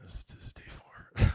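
hushed, unvoiced whisper of disbelief; incredulous whisper. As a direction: whisper it like a doubting bystander. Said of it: "everybody around is like".

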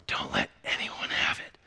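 hushed secret whisper. Acted. Shopkeeper secretly warns Charlie, whispering. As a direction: lean in and whisper the warning. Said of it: "with a whisper".

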